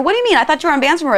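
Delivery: mock outraged disbelief, pitch jumping up; incredulous accusing. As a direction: press them like you don't buy it. Acted accusation in a rap.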